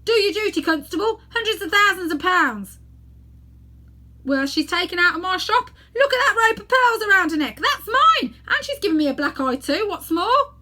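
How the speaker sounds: high, loud, indignant ranting; outraged shout. Acted. Robbed shopkeeper shouting at the constable, outraged and loud. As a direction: bellow for the constable to act. Fat man demands arrest.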